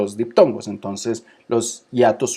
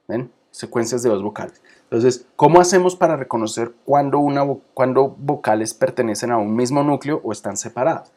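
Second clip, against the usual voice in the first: ordinary talk, nothing marked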